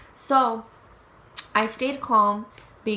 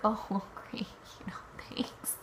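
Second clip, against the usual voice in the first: whispered, dry and sarcastic with a small laugh; 10 dB quieter than usual. Retold or imitated. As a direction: breathe out a mock thank-you. Sarcastic reaction to car towed.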